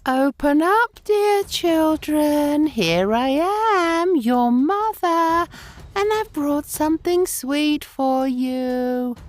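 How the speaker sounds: high, very slow sugary coaxing; wolf faking sweet voice. A narrator's reading. The wolf with softened voice coaxes the kids, slow and high. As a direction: drawl it sweetly like a fake mother.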